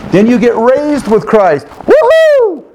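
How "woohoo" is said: excited whoop. Acted. Celebrating rising with Christ.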